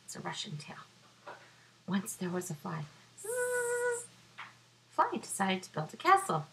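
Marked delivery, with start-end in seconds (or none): 3.2-4.1 buzzing fly. Acted.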